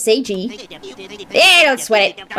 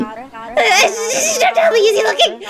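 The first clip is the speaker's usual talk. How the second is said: giggling; 9 semitones higher, slower than usual. Acted. Giddy character talks about crush.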